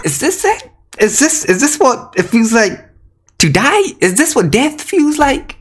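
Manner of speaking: high, whimpering disbelief; melodramatic, high-pitched despair. Real, not acted. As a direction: lament as if dying of disappointment. Mock despair after losing the pull.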